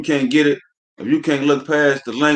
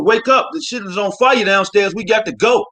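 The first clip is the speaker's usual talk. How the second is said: urgent wake-up yell; 7 semitones higher than usual. Pretend. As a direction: shout someone awake in a burning house. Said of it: "delivering the message like this".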